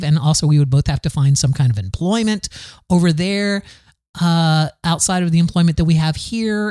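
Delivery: ordinary delivery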